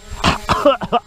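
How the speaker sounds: sudden harsh cough. Real, not acted. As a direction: cough up a swallowed bug. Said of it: "I just ate a bug".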